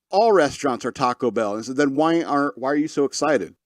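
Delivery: normal talking voice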